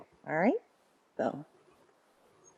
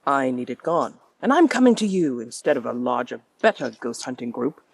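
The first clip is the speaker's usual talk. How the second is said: put-on affected posh British accent, with a sneer; sneering theater owner confessing reluctantly in posh British tones; Preston Burke. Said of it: "an affected posh accent named Preston".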